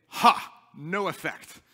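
mock the defiant shrug at suffering; defiant mock taunt. Pastor mocking a defiant believer, slow loud taunt. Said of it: "stand and say".

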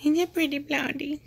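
high sing-song coo at the dog. Real, not acted. Owner cooing to her pretty dog, high baby talk.

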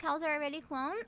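normal talking voice

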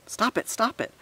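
very high-pitched urgent cry; shout. A narrator's reading. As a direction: squeal an order to stop. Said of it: "all of them shouted".

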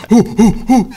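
imitated high crowd hoots; crowd whooping. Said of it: "people are like".

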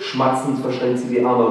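normal talking voice

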